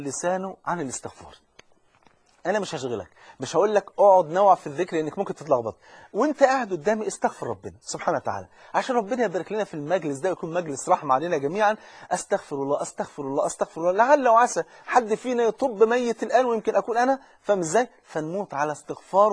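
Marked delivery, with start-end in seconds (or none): none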